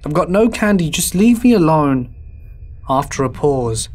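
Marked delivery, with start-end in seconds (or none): 0.0-2.2 loud, high shout at the door; exasperated yell. Acted. Man to trick-or-treaters pounding on his door, exasperated yell. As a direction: yell to drive them off. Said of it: "where I yell".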